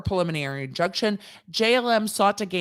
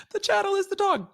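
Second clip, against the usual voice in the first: much higher, giddy reenactment; giddy squeal. Retold or imitated. Host replaying the law school joke, giddy high squeal. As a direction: squeal the punchline again.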